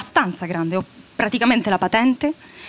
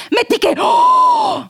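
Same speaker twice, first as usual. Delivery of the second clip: sudden very high slow shout on oh; shocked shriek; 5 dB louder, much slower than usual. Acted. Mother shrieks in horror imagining Wendy in love. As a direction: gasp out loud in horror.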